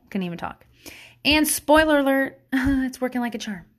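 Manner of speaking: normal talking voice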